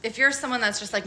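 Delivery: normal talking voice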